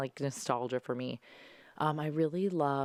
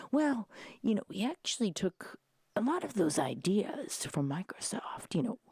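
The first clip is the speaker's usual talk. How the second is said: higher whispered dad; know-it-all dad, whispered; dad impression; 6 semitones higher than usual. Daughter imitating dad explaining Microsoft, whispered. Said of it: "my dad was like".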